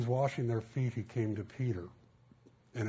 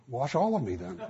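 louder, eager burst of a plea; 3 semitones higher, 6 dB louder than usual. Retold or imitated. Peter eagerly begs Jesus to wash him all over, loud and keen.

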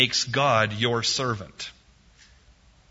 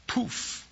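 breathy magic poof; soft, airy spell sound; whispered poof; 11 semitones higher, 7 dB quieter than usual. Retold or imitated. Second wish granted.

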